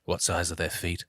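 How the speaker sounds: creepy suspect voice. Acted. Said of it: "I'm a curious man".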